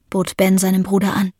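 plain reading voice

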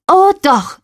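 high, loud, defiant; stubborn call. Acted. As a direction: insist like a stubborn kid. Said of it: "rief ich starrsinnig". (trotzig und laut gerufen: „Oh doch“)

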